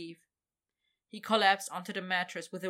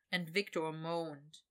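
plain reading voice

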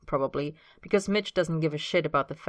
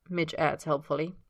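usual speaking voice

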